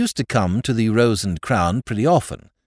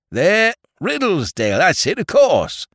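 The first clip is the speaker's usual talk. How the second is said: higher, pleased Cockney find; excited landlord; 10 semitones higher, 4 dB louder than usual. A narrator's reading. Landlord finds the paper, pleased and excited.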